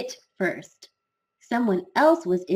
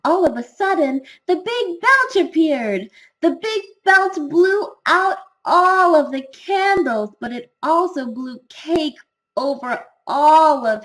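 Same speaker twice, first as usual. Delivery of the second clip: high, excited storytelling; animated, loud story climax; dramatic climax; 6 semitones higher, 4 dB louder than usual. A narrator's reading. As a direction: build the sudden disaster with raised pitch and punched words. Climax of the belch disaster.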